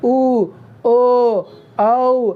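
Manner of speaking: उ, ओ, औ said with clearly rounded lips; rounded vowel demo. Shown as a demonstration. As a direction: show the lips going round on ओ and औ. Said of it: "देखो उ". (होंठ गोल करके उ, ओ, औ)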